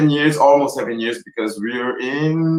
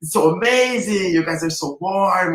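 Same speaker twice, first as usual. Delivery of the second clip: mock excitement; 4 semitones higher than usual. People hearing she's from Brazil, mock excited. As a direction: gush in a higher voice, as if thrilled. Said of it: "like, so excited".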